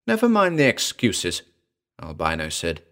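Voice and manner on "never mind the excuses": high-pitched, brusque heroine; impatient jungle girl. A narrator's reading. Jungle girl to excuse-making man, impatient. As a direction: dismiss him in a high girl's voice.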